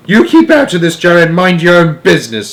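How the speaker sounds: high, loud shrill scolding; shrill grandmother snapping at Joe; angry retort. A narrator's reading. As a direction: tell him off sharply.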